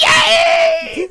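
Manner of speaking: put-on high squealing girly cheer; girl voice. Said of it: "in a girl voice".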